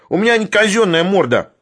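high-pitched, aggrieved protest; indignant squeal. A narrator's reading. Sharikov protests about being slapped, high indignant squeal. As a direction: whine it high in self-defence. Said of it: "взвизгнул Шариков".

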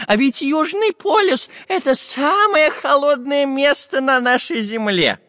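high, slow, excited professor voice; too much caffeine. Acted. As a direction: marvel at it in a high voice. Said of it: "протараторил Семён Семёныч".

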